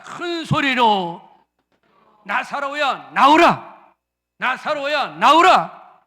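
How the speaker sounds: loud, slow call to come out; loud command. Shown as a demonstration. Pastor calling out Lazarus in a loud voice. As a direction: call the dead man out loudly.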